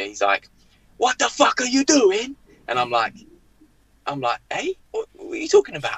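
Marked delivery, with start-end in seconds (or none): 1.0-2.4 high-pitched mock furious roar; acted-out rage, pitched way up; seething outburst. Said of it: "seething, ... breathing fire". 4.5-6.0 high-pitched bewildered question; mock bewilderment, voice pitched up; baffled protest. Baffled player confronted by raging manager.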